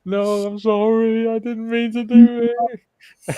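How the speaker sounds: high, slow, pleading apology; apologetic lad. Retold or imitated. Retelling the lad sorry after the prank, high and pleading. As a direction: voice the scared lad, drawn-out and high. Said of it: "He goes".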